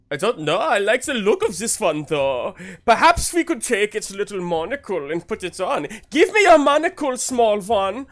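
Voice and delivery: high, loud posh snob; haughty aristocrat demanding; posh noble voice. Acted. Noble demands the little creature hand over its monocle, posh. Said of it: "I just enjoy doing these voices".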